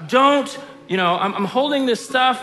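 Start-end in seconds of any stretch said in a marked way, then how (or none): 0.1-0.6 high, loud, sharp don't; urgent parent warning. Retold or imitated. Parent with full hands warning toddler, high and loud. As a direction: stop the child mid-step. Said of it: "I'm sitting here going".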